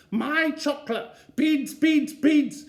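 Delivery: put-on high, loud, wailing toddler chant; toddler screaming. Little Eddie screaming for beans in the supermarket. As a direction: scream it like a furious little boy.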